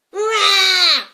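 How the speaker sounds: very loud, high roar; dragon roar. Acted. Dragon roars from the box.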